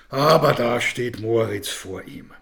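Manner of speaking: ordinary delivery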